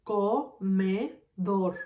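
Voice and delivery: "Comedor" split slowly as co, me, dor; slow "Comedor" with a gap after each syllable. Shown as a demonstration. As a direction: count out "Comedor" in three separate beats. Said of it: "has three syllables".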